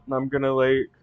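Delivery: ordinary delivery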